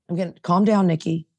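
ordinary delivery

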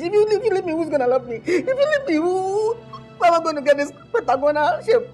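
high, tearful comic wail; hammed-up wailing plea. Boyfriend begging girlfriend not to leave, hammed-up crying. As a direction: beg through exaggerated sobs, pitch soaring.

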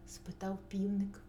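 ordinary delivery, nothing marked

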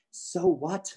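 high-pitched, mildly defiant comeback; lightly voiced mom, brushing off the objection; dismissive retort. A narrator's reading. Mom answering dad in their argument, dismissive retort. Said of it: "Mom replied".

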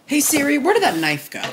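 high, loud call to Siri; sing-song summons to Siri. Real, not acted. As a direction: call out to the phone. Calling out to phone assistant.

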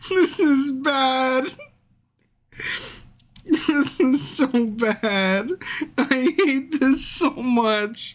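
slow, tearful whine; whimpering despair. Real, not acted. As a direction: drag each phrase out, near tears. Despairs at failed game.

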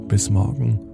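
usual speaking voice

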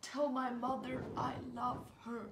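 weak dying whisper; faint last words. Acted. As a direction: breathe out final words.

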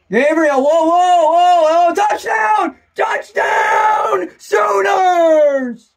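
screaming play-by-play, voice soaring; ecstatic touchdown call. Real, not acted. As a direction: build whoas into a high, loud shout. Calling a go-ahead touchdown.

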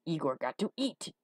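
Igor's rough growl; throaty, emphatic hunchback. A narrator's reading. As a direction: growl it out defensively. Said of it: "in a voice like a growl".

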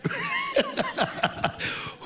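a long high burst of laughter. Real, not acted. Worship leader laughing at her own whoop. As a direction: let the laugh ring out high.

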